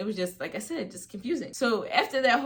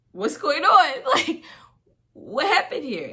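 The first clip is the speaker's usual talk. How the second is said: loud, high imitation of a bewildered mother; alarmed mom; 8 semitones higher, 9 dB louder than usual. Mom demands to know what happened, loud mimicked alarm. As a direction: burst out loudly, pitched high, demanding answers.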